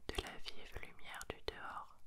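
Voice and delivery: ordinary delivery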